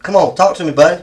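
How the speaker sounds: ordinary delivery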